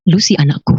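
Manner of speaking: normal talking voice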